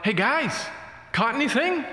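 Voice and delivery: high-pitched call to people out on the water; mild mock yell. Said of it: "a stranger on the lakeshore yells out".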